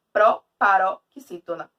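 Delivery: slow, loud "proparoxítona", split as pro, paro, xítona; word broken into parts. Shown as a demonstration. Teacher showing a word inside another, word broken into parts. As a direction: set out each piece of "proparoxítona" separately. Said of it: "Uma palavra dentro da outra".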